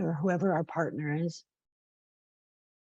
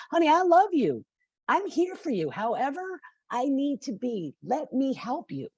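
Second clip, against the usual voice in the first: tender; 7 semitones higher than usual. Acted. Acted gentle talk to a friend.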